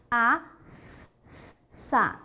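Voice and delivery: "A, s, s, sa" taken apart sound by sound; single S sound. Shown as a demonstration. Teacher demonstrating one S sound, sounded out separately. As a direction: isolate each hissed "s" before landing on "sa". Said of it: "é um único S".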